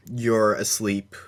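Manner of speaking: usual speaking voice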